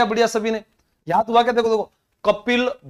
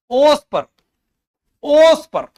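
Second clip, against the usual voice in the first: raised and energetic; lively emphasis; 7 semitones higher, 6 dB louder, slower than usual. Shown as a demonstration. Teacher drilling a memory trick, raised and lively. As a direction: punch it out to fix the memory. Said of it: "mnemonic emphasis".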